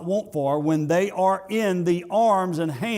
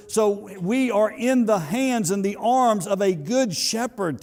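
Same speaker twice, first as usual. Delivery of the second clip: preaching; 6 semitones higher, 3 dB louder than usual. Acted. Preacher building to a peak.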